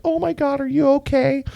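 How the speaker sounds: high-pitched mock worry; high, over-concerned voice; fussy concern. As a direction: imitate someone fretting over grandma. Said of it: "and being like".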